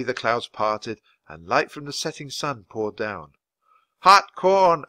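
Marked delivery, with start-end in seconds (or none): 4.0-4.9 loud, high street-vendor call; peddler's shout. A narrator's reading. Peddler shouting to sell his hot corn, loud call. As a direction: bellow it out to the park crowd. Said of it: "a peddler shouted".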